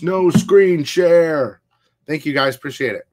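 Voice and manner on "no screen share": very slow, higher, louder mock refusal; imitating a chatter refusing, drawn-out mock voice; mock quote of a viewer. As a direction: drag it out in a silly put-on voice. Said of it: "Rudy's like".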